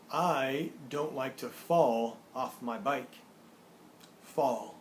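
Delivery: put-on slow, deliberate model reading for young learners; measured teaching read-aloud with drawn-out key words; word-card reading. Shown as a demonstration. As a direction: announce each word and sentence distinctly, unhurried. Reads word cards with example sentences.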